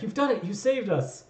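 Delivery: high, excited cheer of freed workers; joyful shout. Acted. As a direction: cry out in thanks. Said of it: "shouting and joy".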